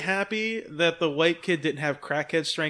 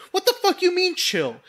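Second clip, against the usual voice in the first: mock angry; 12 semitones higher, 3 dB louder than usual. Clerk angrily answering the kid.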